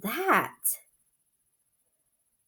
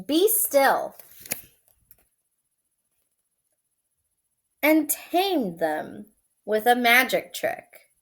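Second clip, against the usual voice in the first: story reading; 4 semitones higher, 6 dB louder than usual. A narrator's reading. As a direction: read it like a bedtime story. Reads picture book aloud.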